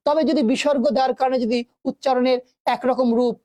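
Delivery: usual speaking voice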